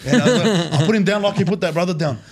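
ordinary delivery

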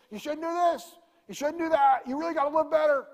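high, loud nagging legalist voice; mock scolder. Preacher mimicking legalists lecturing people, high and loud. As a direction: wag a finger at the congregation.

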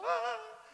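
high, loud imitated wail; mock wail. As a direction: burst into a hammed-up cry. Retold cry at altar call.

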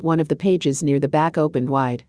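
ordinary delivery

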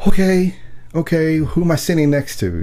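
high-pitched, stunned reaction; retold flabbergasted. Concertgoer amazed by the man next to him, flabbergasted.

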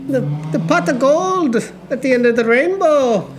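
mock Irish. Asked for an Irish accent, friend riffs on leprechauns in mock Irish. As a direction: ham up a stage Irish lilt. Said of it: "Can you do an Irish?".

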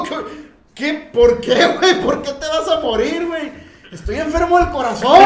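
high, loud alarmed questions; alarmed shouting. Retold or imitated. Narrator answering his panicked friend, loud and high.

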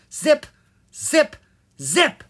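high, loud repeated shout; yelling. A narrator's reading. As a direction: shout each word at him. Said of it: "she yelled loudly".

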